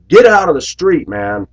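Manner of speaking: put-on high, loud shout at the kid; shouted order. Bus driver yelling at kid in street, loud shout. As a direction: yell it out the bus door.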